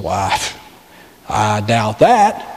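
low, slow skeptical reply; doubting. Acted. Listener answers the preacher's belief, doubting.

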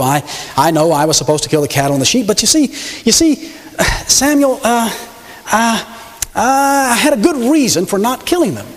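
hemming and hawing, caught out; a king caught lying, stammering excuses; flustered stammering king. Acted. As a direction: stall for time with every uh. Saul making excuses.

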